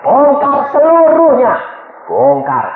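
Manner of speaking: loud, high, drawn-out order; forceful preacher's command. Retold or imitated. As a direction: demand a full confession at full force. Ordering wife to confess.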